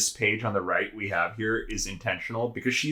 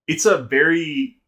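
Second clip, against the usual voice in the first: ordinary talk, nothing marked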